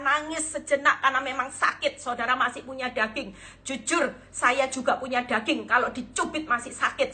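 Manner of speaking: normal talking voice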